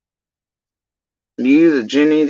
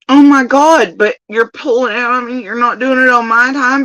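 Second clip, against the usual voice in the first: higher, louder whiny guilt-trip; mock manipulative plea; 8 semitones higher, 4 dB louder than usual. Imitating Ziggy guilt-tripping a guest to appear, in a higher whiny voice. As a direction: whine as if pressuring a guest. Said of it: "be like".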